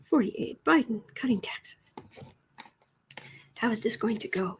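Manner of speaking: soft unvoiced whisper. Real, not acted. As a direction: keep it to a hushed whisper. Whispering beside the cat.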